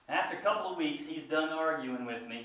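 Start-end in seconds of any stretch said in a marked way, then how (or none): none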